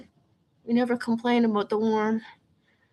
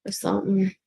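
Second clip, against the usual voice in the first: ordinary talk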